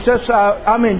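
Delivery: normal talking voice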